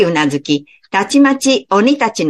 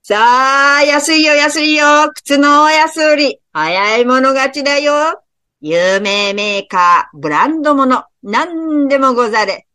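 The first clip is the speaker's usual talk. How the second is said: loudspeaker hawker; 6 semitones higher than usual. Acted. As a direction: shout the bargain like a street hawker. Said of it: "大きな声がスピーカーから". (スピーカーから響く呼び込みの声)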